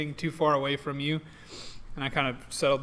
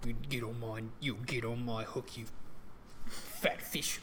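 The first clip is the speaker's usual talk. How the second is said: muttering to fish; 5 semitones lower, 13 dB quieter than usual. Acted. Coaxing a fish on the hook.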